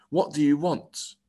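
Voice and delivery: guard's pointed challenge; demanding. A narrator's reading. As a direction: press for an answer. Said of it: "he demanded".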